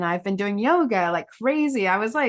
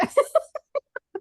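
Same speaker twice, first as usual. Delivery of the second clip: high laugh. Real, not acted. Laughing at her own irony.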